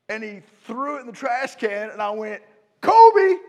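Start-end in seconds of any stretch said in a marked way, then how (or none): 2.8-3.4 loud high basketball yell; excited shout. Retold or imitated. Pastor acting his dream, yelling at Shaq's trash-can shot. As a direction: yell it like a swish from downtown.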